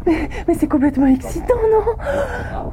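high, thrilled and rushing; bursting with excitement; excited. Acted. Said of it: "Faut que je me calme".

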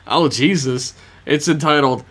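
normal talking voice

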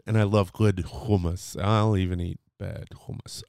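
ordinary talk

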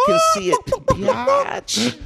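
squeaky high sneer; fast mocking falsetto. Mocking gang tagger.

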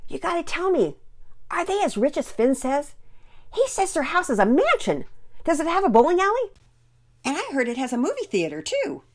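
put-on high, loud, eager boy voice; excited little brother. A narrator's reading. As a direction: fire off questions in wide-eyed excitement. Eager brother quizzing about mansion.

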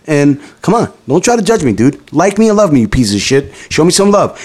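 ordinary talk

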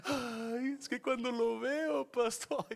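high, gushing swoon building to a shout; swooning lovesick voice. Retold or imitated. Congregant gushing to the pastor, high swooning voice. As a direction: melt with giddy infatuation, rising to a cry.

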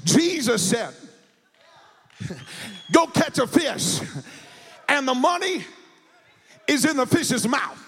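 full-force preaching. Acted. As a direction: rouse the church to praise. Preacher tells the story, building the refrain.

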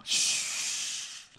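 long, soft "shh"; an insistent airy hush; shushing. Retold or imitated. Father imitating his daughter listening for brother, shushing. Acting out daughter hushing everyone.

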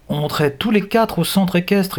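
put-on high young girl's voice. A narrator's reading. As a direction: explain it as a girl to her captors. Young girl explains to captors.

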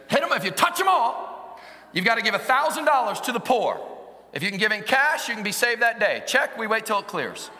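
loud, high-pitched preaching. Acted.

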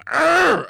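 loud, high primal roar; mock roar. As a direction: let out a hammed-up battle cry. Jealous guy's burst of passion.

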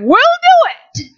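very high, loud, slow declaration; eager volunteering. Retold or imitated. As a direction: step up with excitement. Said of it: "And we said".

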